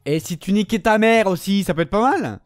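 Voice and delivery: raging gamer tirade; furious cursing. Real, not acted. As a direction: yell insults at the enemy. Cursing an enemy's movement.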